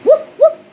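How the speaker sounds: high, loud yapping woofs; dog barks. Pretend. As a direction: bark like a little dog. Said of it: "The dog says".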